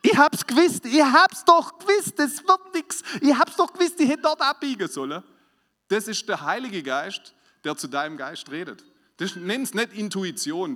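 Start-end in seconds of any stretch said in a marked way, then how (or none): 0.0-5.2 higher, much louder, whiny wail of regret; exasperated self-reproach. Retold or imitated. Believer looking back on a failed day, loud whiny regret. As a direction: wail it like you knew all along.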